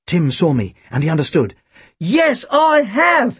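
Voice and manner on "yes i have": high, very drawn-out exclamation; exclaiming. A narrator's reading. Partner catching on and bluffing the guard, exclaiming. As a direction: exclaim it high, stretching each word. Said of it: "he exclaimed".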